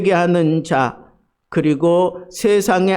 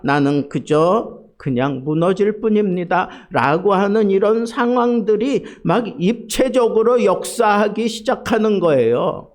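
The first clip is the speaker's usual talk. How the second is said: normal talking voice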